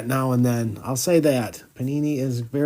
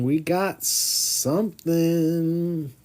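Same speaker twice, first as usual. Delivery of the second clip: drawn-out tease; 3 semitones higher, much slower than usual. Acted. Slow suspenseful tease before reveal.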